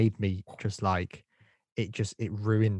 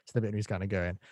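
ordinary talk

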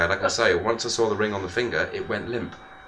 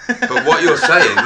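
higher, louder, slow, laughing through words; 6 semitones higher, 7 dB louder, slower than usual. Real, not acted. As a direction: laugh as you talk. Laughing while speaking.

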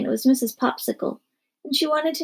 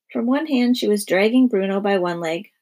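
plain reading voice

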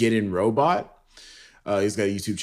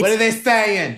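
high eager son answering mom; 11 semitones higher, 3 dB louder than usual. Pretend. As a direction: call back to Ma, raised and high. Son answering his mother.

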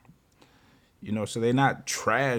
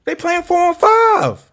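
incredulous; 8 dB louder, slower than usual. Real, not acted. Incredulous high exclamation.

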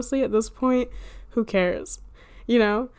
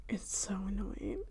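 whispered aside; 13 dB quieter than usual. Real, not acted. Frustrated aside about herself.

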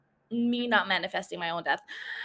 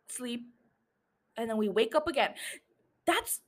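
ordinary talk, nothing marked

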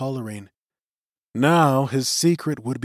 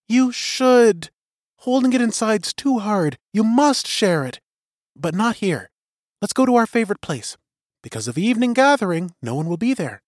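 higher, eager coaxing woman's voice; urging young woman; 9 semitones higher, 4 dB louder than usual. A narrator's reading. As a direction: coax him, quick and eager. Suri urges Till to share.

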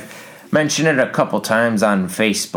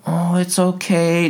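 higher, slow mock soothing; mock consoling; 8 semitones higher, slower than usual. Family members comforting the loser, slow mock soothing. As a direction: parody gentle family comfort. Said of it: "they're like".

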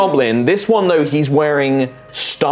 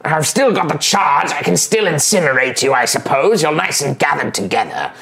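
higher, condescending goblin voice, touches of threat; smart goblin; 5 semitones higher than usual. Acted. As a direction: sound like a clever goblin tired of fools.

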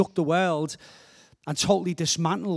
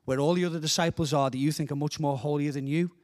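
ordinary talk, nothing marked

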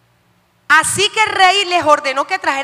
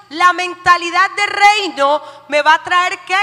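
usual speaking voice

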